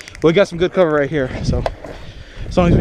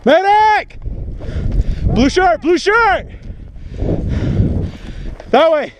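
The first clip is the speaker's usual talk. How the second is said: shouting for medic; 12 semitones higher, much slower than usual. Real, not acted. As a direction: yell out to guide the medic. Calling out to the medic.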